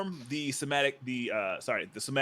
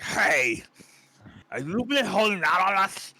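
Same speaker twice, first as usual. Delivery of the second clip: loud orc, rising high; orc confrontation; 6 semitones higher, 7 dB louder than usual. Acted. Orcs closing in on the player, loud confronting. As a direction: challenge them loudly in an orc voice. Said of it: "be like".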